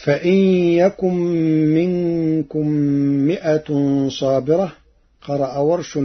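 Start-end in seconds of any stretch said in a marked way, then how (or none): 0.0-4.7 Quranic recitation. Shown as a demonstration. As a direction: intone it word by word. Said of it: "verse recitation".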